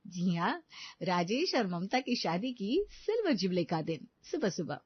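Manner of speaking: usual speaking voice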